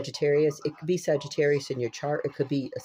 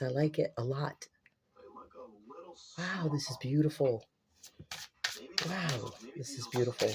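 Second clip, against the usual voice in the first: hushed awe; 5 dB quieter than usual. Real, not acted. Marvelling softly at the cards.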